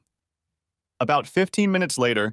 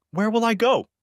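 high-pitched, lightly acted tearful wail; helpless plea with a touch of crying; crying question; 12 semitones higher than usual. A narrator's reading. Wife at her car asks husband, mildly tearful. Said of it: "she asked, crying".

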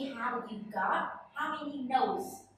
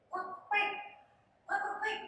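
duck quack; 6 semitones higher, 4 dB quieter, faster than usual. Shown as a demonstration. Teacher imitates duck sound.

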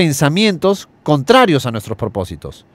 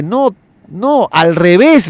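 higher, louder correction, clearly irritated; annoyed protest; 6 semitones higher, 5 dB louder, much slower than usual. Acted. Young man annoyed at the genie, protesting angrily. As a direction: snap back that it's the wrong way.

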